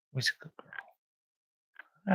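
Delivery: high, slow whispered coo. Real, not acted. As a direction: stretch it out, whispering to a pup. Cooing to newborn puppy.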